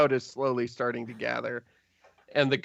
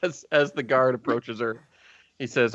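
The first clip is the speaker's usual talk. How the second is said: ordinary talk, nothing marked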